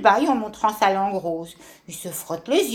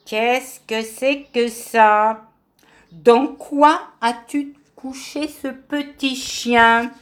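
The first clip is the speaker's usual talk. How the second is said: slow, louder interrogation; stern questioning; 3 semitones higher, 5 dB louder, much slower than usual. Acted. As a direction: drag each word out sternly. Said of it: "demande sévèrement".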